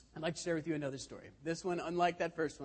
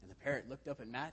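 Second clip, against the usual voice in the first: normal talking voice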